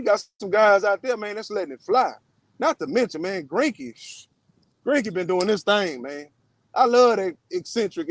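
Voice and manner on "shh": shushing hiss. Real, not acted. Hushing before Greinke.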